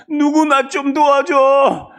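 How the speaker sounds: acted plea of a dying victim, shouted on long vowels; crying for help. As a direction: wail out slowly for someone, anyone to help. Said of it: "하고 외치는 겁니다".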